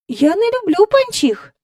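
put-on high-pitched child voice; little girl. A narrator's reading. Girl answering the visitor back, high child voice. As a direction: answer in a small girl's high register.

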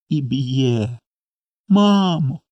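put-on mild tearful child's plea; breaking into tears. A narrator's reading. Small boy tells mama of beatings, starting to cry. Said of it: "заплакав одразу Коля".

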